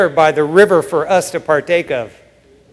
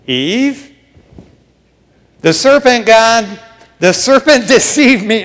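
Eve pleading, louder and higher; mock Eve passing blame; 4 semitones higher, 5 dB louder than usual. Preacher voicing Eve blaming the serpent, pleading excuse. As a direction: point the finger at the serpent.

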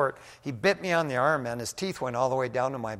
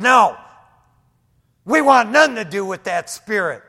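demon voice; 5 semitones higher, 12 dB louder than usual. Retold or imitated. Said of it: "that deep voice came back".

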